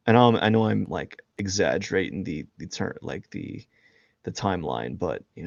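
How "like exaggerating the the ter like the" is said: usual speaking voice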